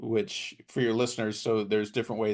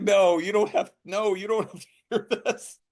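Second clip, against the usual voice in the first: higher, a lightly voiced nervous backpedal; flustered protest; 10 semitones higher, 5 dB louder than usual. Author turning down a pitch request, flustered and higher. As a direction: wave the offer away, embarrassed and pitched up. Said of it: "And I'm like".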